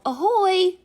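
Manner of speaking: high, loud call of ahoy; a pirate's loud, high greeting. A narrator's reading. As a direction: hail the crew with a cheery ahoy. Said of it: "All the pirates called".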